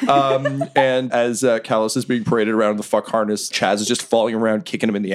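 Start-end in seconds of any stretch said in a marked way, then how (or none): none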